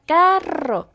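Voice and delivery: "carro" high and loud with a hard trilled R; effortful rolled R. Retold or imitated. Daughter showing her new R, "carro" trilled with effort. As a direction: push out the rr in "carro" like a striving child. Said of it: "Y se esforzaba".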